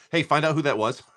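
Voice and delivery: usual speaking voice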